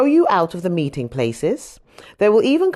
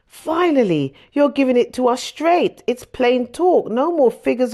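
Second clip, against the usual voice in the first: lightly raised, eager disciples' voice, higher; a group of followers relieved at last, voiced higher; disciples speaking; 6 semitones higher than usual. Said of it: "His disciples said".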